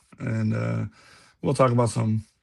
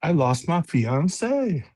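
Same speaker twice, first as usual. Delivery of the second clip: Seinfeld impression; 5 semitones higher, much slower than usual.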